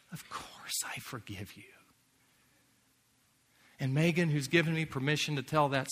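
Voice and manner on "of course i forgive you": softer, breathed whisper; tender whisper. Retold or imitated. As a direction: whisper the forgiveness. Repeated forgiveness, retold.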